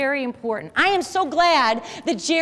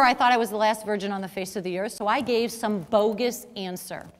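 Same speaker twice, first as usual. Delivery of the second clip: ordinary talk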